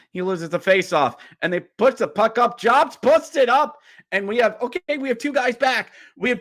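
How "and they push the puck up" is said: usual speaking voice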